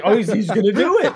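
high, loud, laughing burst of glee; excited. Retold or imitated. Friend recalling the scene, shrieking with excited laughter. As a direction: squeal that he's really going to do it. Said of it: "I was like".

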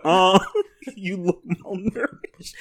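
slow, laughing [name] quote; lightly voiced [name] rap line; slow mock [name] line. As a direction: drawl it slowly through a laugh. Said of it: "he was like".

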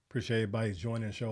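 normal talking voice

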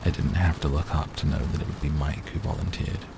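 ordinary delivery

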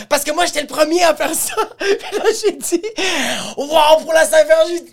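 high, laughing, worked-up telling; laughing excitement. Real, not acted. Confessing he did it first, high laughing excitement. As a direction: burst out high through real laughter.

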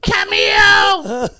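high, loud comic holler; mock catchphrase shout. Friend chimes in on the impressions, high comic shout.

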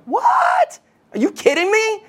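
shrill high shout of disbelief; shocked disbelief. Retold or imitated. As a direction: shout it like the crowd can't believe it. Throne room crowd reacts in disbelief.

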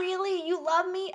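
squeaky high "really, you love me"; high mock surprise. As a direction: play it high and breathless. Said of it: "she's like".

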